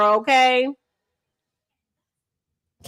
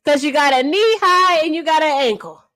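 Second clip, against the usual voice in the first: ordinary talk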